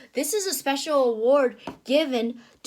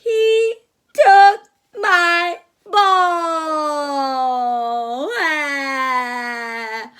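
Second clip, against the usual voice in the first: slow wailing sob, louder; crying Piggy; 5 dB louder, much slower than usual. Acted. Piggy crying to Elephant about her ball, slow wail. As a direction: wail it like a heartbroken little pig.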